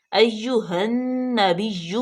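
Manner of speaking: "ایہا النبی" very slow, doubled نون pressed; drawn-out "ایہا النبی"; careful recitation. Shown as a demonstration. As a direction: model "ایہا النبی" slowly. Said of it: "حمزہ زبر یا".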